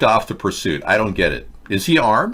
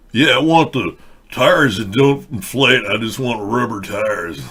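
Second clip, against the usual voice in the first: customer voice; slower than usual. Pretend. Mock customer at tire shop.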